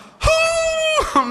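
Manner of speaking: a huge high laughing shout; loud laugh. Real, not acted. Preacher bursting out laughing at the punchline, loud. Crowd-worthy outburst laugh.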